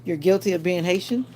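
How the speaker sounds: ordinary delivery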